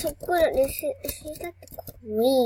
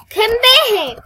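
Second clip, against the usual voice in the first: loud high call of a name; calling out loudly; 10 semitones higher, 14 dB louder than usual. Real, not acted. Kid yelling a friend's name across the game. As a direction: yell the name to get her attention.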